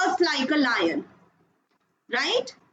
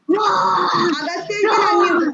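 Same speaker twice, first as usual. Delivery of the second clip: calling out; 4 semitones higher, 4 dB louder than usual. Real, not acted. Calling out to a child.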